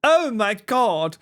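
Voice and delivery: high, very slow oh my God; stunned, stretched-out exclamation; astonished. Real, not acted. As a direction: draw it out in amazement. Reacting to Perry's voice clip.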